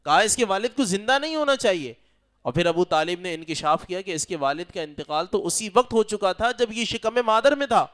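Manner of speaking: ordinary talk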